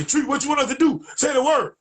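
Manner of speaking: high, loud call from the background; eager shout. Retold or imitated. Partner in the background calling to Tree, high loud shout. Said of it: "partners in the background say".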